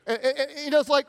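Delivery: ordinary talk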